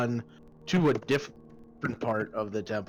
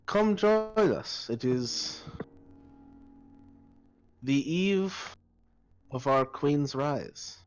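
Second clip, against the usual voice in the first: put-on high, slow Drow priestess accent; 4 semitones higher, slower than usual. Priestess inviting a sister to join, Drow accent. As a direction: stretch the words in a stately Drow accent. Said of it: "the Drow were this accent".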